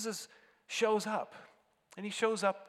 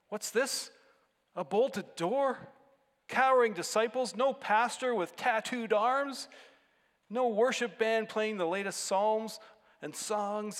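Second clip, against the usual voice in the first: higher, louder, questioning disbelief; mock incredulous visitor; 5 semitones higher, 6 dB louder than usual. Family stumbling onto the early church, mock incredulous. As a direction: list each shortcoming as a baffled question.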